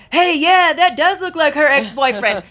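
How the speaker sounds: high, loud imitated call-out; husband's loud exclamation. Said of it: "he was like".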